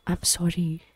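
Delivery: soft, hushed apology; murmur. A narrator's reading. Husband murmuring sorry to his upset wife. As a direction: murmur it close to her ear. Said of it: "he murmurs".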